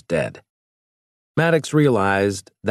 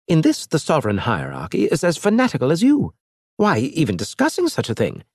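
higher-pitched alien envoy, scornful; lightly raised alien voice; alien Elge; 8 semitones higher than usual. Acted. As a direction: play the proud alien mocking the captain.

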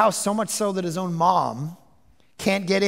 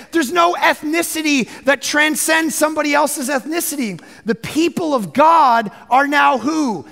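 preaching at full force, higher and louder; fiery preaching; 8 semitones higher, 6 dB louder than usual. Acted. Pastor proclaims religion is over, preaching at full force. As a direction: build it up and proclaim it to the congregation.

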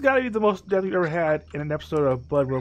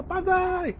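battle cry; 14 semitones higher than usual. Pretend. Charging into enemies.

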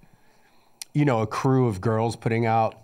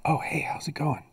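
hushed phone whisper; 6 dB quieter than usual. Pretend. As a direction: whisper into the receiver. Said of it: "I have to whisper".

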